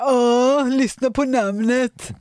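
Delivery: hammed-up impression of a friend's reaction; mocking imitation. Teasing her co-host by acting out her reaction, exaggerated mock tone. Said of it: "Nu kommer du säga så här".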